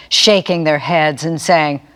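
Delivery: plain reading voice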